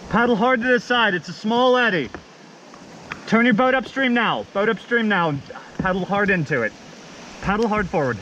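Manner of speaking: shouting over rushing water. Real, not acted. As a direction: yell instructions to paddlers downstream. Instructing paddlers across river noise.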